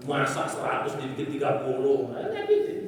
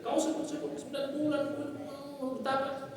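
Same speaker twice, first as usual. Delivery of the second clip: preacher quoting himself; 10 semitones higher, 7 dB quieter than usual. Retold or imitated. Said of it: "Saya bilang".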